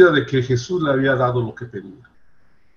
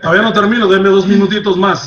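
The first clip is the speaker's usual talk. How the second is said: laughing plea; 5 semitones higher, 7 dB louder, faster than usual. Real, not acted. Preacher begging more time, laughing.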